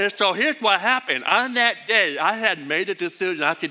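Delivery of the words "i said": ordinary talk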